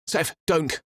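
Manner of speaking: high urgent call across the bridge; urgent shout of warning. Acted. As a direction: call out to stop him. Peter calls out to Safiri.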